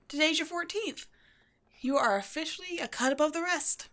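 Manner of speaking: ordinary talk, nothing marked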